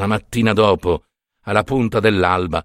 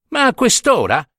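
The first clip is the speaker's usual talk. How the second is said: high-pitched, exasperated protest; huffing; 11 semitones higher than usual. A narrator's reading. Curate grumbles at being asked so late, huffing. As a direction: huff at the late request. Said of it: "ripeté don Marchino sbuffando".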